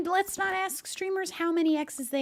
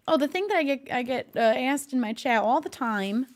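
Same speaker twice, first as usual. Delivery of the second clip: usual speaking voice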